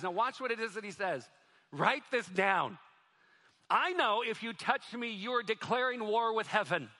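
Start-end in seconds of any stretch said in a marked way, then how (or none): 1.7-6.9 defiant declaration. Retold or imitated. Brahim warning the men who threatened him, defiant and loud. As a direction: declare it like a challenge to the attackers.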